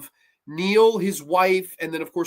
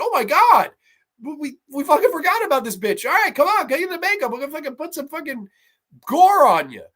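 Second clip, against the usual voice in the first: high, hurried pretend panic; hammed-up alarmed realization; mock panicked crew; 10 semitones higher, 5 dB louder than usual. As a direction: bark frantic last-minute orders on a film set. Acting out panicked film crew.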